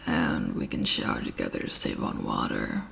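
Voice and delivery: deadpan suggestive, low; very low, soft deadpan; deep fanfic Eraser. A narrator's reading. Shota's deep voice.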